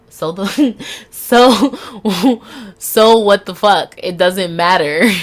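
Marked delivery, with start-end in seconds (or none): 0.1-4.0 loud laugh breaking through a defiant question; giggling through a defiant so what; laughing defiance. Real, not acted. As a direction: laugh it out, louder and louder. Laughing at her own defiant self-talk.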